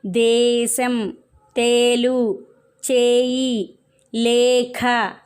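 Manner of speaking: slow word list, first syllable held on a long "ē" as in "దేశం"; long ē demonstration. Shown as a demonstration. Teacher showing pupils words with ఏత్వం, slowly drawn-out demonstration. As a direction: draw out the "ē" in "తేలు", "మేక", each word separate. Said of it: "మొదటి అక్షరాన్ని ఏతో పలకాలి".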